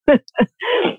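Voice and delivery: quick high laugh. Real, not acted. Guest laughs at her cheese proverb, quick high laugh. Laughs after Bulgarian saying.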